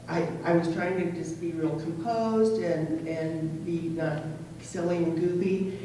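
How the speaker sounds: ordinary delivery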